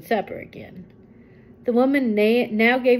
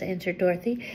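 usual speaking voice

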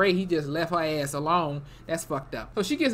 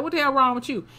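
retold indignation; 7 semitones higher than usual. Acting Chet's angry attitude.

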